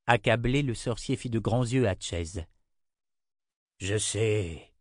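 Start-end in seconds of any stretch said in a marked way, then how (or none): 3.8-4.7 weary, understated sigh. A narrator's reading. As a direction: sigh it in resignation. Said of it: "soupira le garde-frontière".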